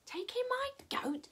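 high, very soft, incredulous query; puzzled question. Acted. Old lady doubts the goat advice, puzzled.

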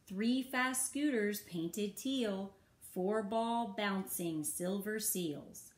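usual speaking voice

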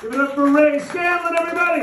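high-pitched, rousing stage announcement; rally the crowd to applaud; announcer call-out. Real, not acted. Host sending off a comic, rousing call.

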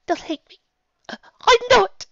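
put-on high sniffling child voice. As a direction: sniffle through it, high and small. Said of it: "dropped her head and sniffled".

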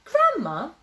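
high, loud questioning call; abrupt, high-pitched call for Grandma; sudden question. A narrator's reading. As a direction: pipe up suddenly to get attention. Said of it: "asked Little Bear suddenly".

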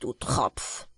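low, breathy put-down; mocking dig; scornful aside. A narrator's reading. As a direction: dismiss him under your breath. Nix scoffs at Jack.

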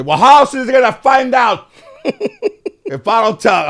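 high, loud retort in a grandmother's voice; grandmother's shout. Retold or imitated. Grandmother answering those who say don't tell him, shouting. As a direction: yell it back as an indignant grandmother. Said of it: "And my grandmother would say".

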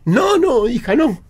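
a hint of a mum pleading with her daughter; mother pleading.

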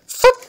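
efeito sonoro guinchado bem alto; fup agudo e forte. Acted. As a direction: estala o som da gaveta. Gaveta se abre. (fup, efeito agudo e forte)

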